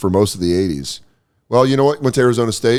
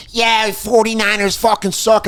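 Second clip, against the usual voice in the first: higher, slow jeering fan voice; mock taunting fan; 10 semitones higher than usual. Fan imitating Philly fans mocking the Niners, high slow jeer.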